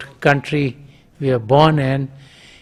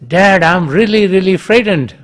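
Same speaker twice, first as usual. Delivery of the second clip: higher, louder frightened call; 5 semitones higher, 6 dB louder than usual. Retold or imitated. Boy calls his father again, higher and louder. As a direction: plead loudly for Dad to hear. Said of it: "Calls back again".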